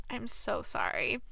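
slow, soft fake apology; drawn-out, mildly phony regret; insincere. A narrator's reading. Kate after stepping on Anthony's hand, insincere. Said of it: "she said rather insincerely".

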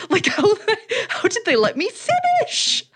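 high, laughing through the question; cracking up at how bad it was; laughing disbelief. Real, not acted. Laughing at terrible set.

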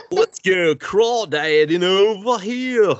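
high, drawn-out British hillbilly with a pinch of goofy; botched hillbilly accent gone British. Acted. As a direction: stretch it slowly into a weird British-hillbilly twang. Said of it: "That was like a British hillbilly".

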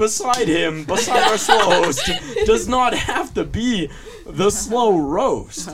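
high, loud, laughing through words. Real, not acted. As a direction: talk while cracking up, slowed.

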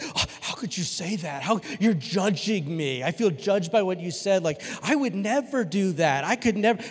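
hammed-up wounded outrage; mock offended. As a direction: act scandalized at being judged. Acting out offended churchgoers when confronted.

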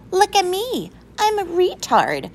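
high, loud mocking schoolgirl voice; showing off to mock a classmate; cruel mimicking. A narrator's reading. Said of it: "mimicked Willie".

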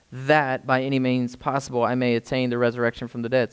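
low, hushed scripture reading. Acted. Preacher reading the verses to the congregation, hushed. Reads scripture slowly, softly.

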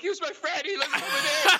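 high, loud mock wail; hysterical crying. Crying kid ratting out friends to cop, hysterical. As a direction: blubber it out in imitated panic. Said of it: "hysterically crying and freaking out".

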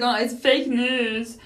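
drawn-out mocking fake news; mocking the friend who says it's fake news, drawn out; slow dismissive mimic. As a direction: imitate her scoffing, slowly. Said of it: "She's like".

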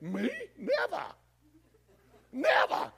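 very high, squawked denial; mock indignation. Believer denying he would cheat, shrill mock outrage. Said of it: "You'd be like".

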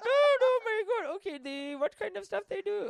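very high, gushing accented mock thrill; dazed overexcitement. Local hungry for details of the sex story, overexcited mock voice. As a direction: squeal with hammed-up, breathless curiosity. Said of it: "all the blood is out of their brain".

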